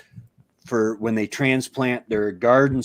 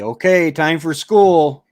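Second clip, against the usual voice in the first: stepfather calling upstairs; 6 semitones higher, 8 dB louder than usual. Retold or imitated. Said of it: "he'd say".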